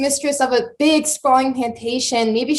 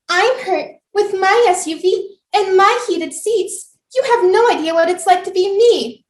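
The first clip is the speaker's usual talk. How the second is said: whiny Karen voice; 7 semitones higher than usual. Retold or imitated. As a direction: whine like a spoiled woman.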